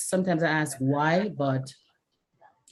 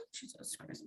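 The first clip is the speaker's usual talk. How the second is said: whispered exasperation; 27 dB quieter than usual. Real, not acted. Exasperated under breath.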